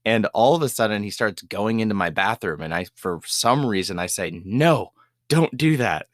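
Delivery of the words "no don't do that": slow, scolding as if to a pet; telling the raccoon off like a child, slow scolding; talking to a dog. Retold or imitated. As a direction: tell off a naughty puppy, slowly. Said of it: "Like I'm almost talking to a dog or to a child".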